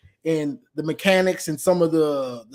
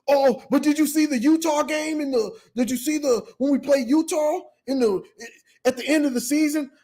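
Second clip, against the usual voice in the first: mock defensive whine; 7 semitones higher than usual. Mechanic defending his team.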